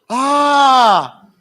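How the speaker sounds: loud, high, long "aah"; drawn-out "ah" of realization. Retold or imitated. Whole class finally getting it, long loud "aah". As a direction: let out a big mock collective "ah".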